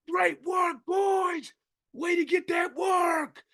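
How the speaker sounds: full-volume, high coach's holler; retold cheering shout. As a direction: cheer the team on from far away, drawn out. Re-enacts cheering on the team.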